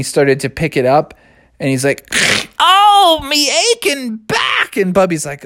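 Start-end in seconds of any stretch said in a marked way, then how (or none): 2.6-4.8 put-on groaning old owl. Old owl strains his back.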